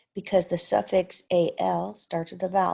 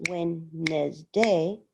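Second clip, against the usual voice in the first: Wednesday stretched slowly syllable by syllable; slow, counting Wednesday; counted out slowly; much slower than usual. Shown as a demonstration. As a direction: draw out Wednesday to count the beats. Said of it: "if you counted it out".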